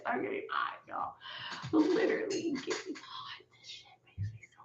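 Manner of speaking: breathy whisper of real rising anger. Real, not acted. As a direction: hiss it almost voiceless, boiling inside. Getting worked up, drops to whisper.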